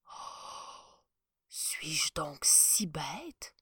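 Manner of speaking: slow, hushed inner voice; chancellor's whispered worried thought; thinking aloud. Acted. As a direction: ask yourself in dread, very slow. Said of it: "pensait-il".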